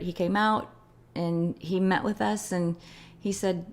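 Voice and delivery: ordinary talk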